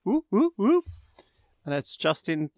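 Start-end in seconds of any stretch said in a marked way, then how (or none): 0.0-0.8 high-pitched celebratory woo; high cheer. Real, not acted. Announcing the live event date with excited woos. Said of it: "a time for our next Facebook Live event".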